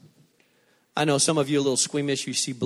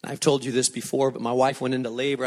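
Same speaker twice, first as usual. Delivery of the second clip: ordinary talk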